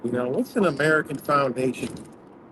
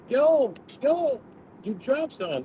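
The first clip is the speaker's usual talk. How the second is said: calling out; 10 semitones higher than usual. Retold or imitated. Stranger calls out from car.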